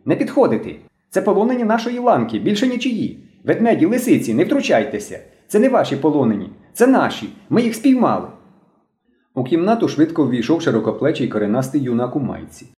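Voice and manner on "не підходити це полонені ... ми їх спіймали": put-on high-pitched boy claiming his captives; excited shouting. A narrator's reading. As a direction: protest high and urgent, without real loudness. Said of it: "кричав білобрисий".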